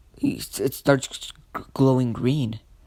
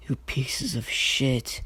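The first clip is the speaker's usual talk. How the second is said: softer, slow, in a put-on voice; quiet menace; 5 dB quieter, slower than usual. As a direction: let the contempt drip out slowly. Naruto seethes at his bullies.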